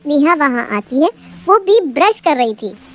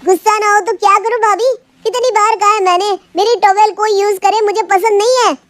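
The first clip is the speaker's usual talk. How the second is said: higher-pitched, mildly acted anger; angry complaint; 6 semitones higher than usual. Brother-in-law complains to sister-in-law about his towel, mildly angry. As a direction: complain crossly, without really shouting. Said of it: "Sumit कमरे से चिल्लाते हुए बाहर आता है".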